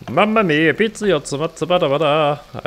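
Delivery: high, bouncy mock Italian chef; sing-song fake Italian gibberish, hammed up; jolly italian pizza chef. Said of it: "As grandmother used to say".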